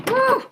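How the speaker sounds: short high "woo"; high loud whoop. Real, not acted. Vlogger cheering a working microphone, high whoop. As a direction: let out a quick loud whoop.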